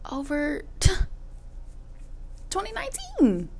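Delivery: squeaky laughing high pitch. Real, not acted.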